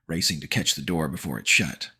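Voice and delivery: usual speaking voice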